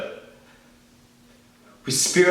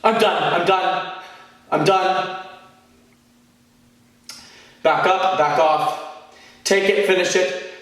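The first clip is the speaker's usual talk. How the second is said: raised, higher voice; angry refusal; 6 semitones higher, slower than usual. Acted. Speaker telling them to back off, heated and raised.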